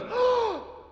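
very high loud gasp of shock; terrified gasp. Acted. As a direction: gasp in sudden terror. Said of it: "he goes".

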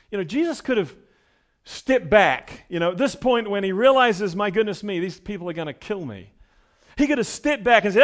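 loud, building sermon delivery; rising pulpit intensity; full-force preaching. Acted. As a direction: preach it out to the congregation.

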